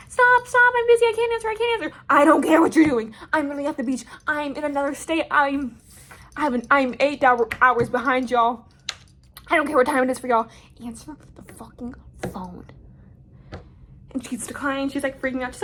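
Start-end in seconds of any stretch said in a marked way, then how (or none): 0.1-2.0 much higher, louder frantic pleading; high panicky girl. Retold or imitated. Other girl voiced, very high. 2.1-10.5 louder, mock-furious rant; hammed-up fury; mock angry yell. Own retold yell, louder. 10.8-12.7 whispered threat; menacing whisper. Retold or imitated. Unvoiced whisper after yelling.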